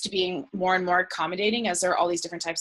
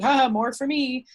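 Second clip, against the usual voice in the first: imitating a gloating joker, higher and louder; mock gloat led by a fake haha; teasing jab; 6 semitones higher, 5 dB louder than usual. Friend claiming a vegan's meat portion, teasing jab. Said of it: "a bit of a, of a jab like that".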